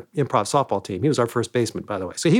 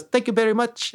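put-on higher-pitched foreign man accent; imitated vague foreign accent, pitched up; Andy Kaufman; 7 semitones higher than usual. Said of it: "that foreign man voice".

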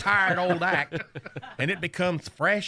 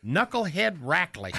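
ordinary talk, nothing marked

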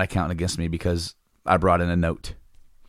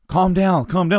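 mock staff; 9 semitones higher, 4 dB louder than usual. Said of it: "they're like".

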